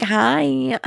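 put-on high, drawn-out Valley Girl greeting. Shown as a demonstration. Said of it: "put on a Valley Girl one".

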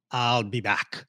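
a hint of a German accent. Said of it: "the way you said it with the, the German accent".

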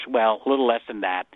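normal talking voice

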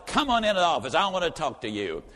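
ordinary delivery

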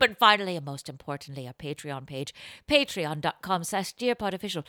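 ordinary talk, nothing marked

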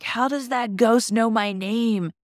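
lightly acted baffled fright; mock alarm. Retold friend spooked in haunted yard.

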